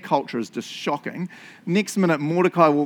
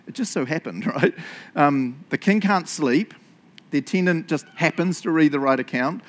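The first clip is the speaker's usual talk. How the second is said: normal talking voice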